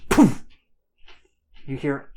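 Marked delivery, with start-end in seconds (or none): 0.1-0.5 a high, loud puff for a blast; loud poof effect. Acted. Narrator makes the blast noise, loud poof. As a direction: pop it out like a small explosion.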